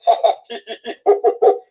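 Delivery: loud shrill animal laughter; high mocking laughter. A narrator's reading. Animals laugh at Anansi, loud and high. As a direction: laugh at the tiny spider. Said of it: "The animals laughed".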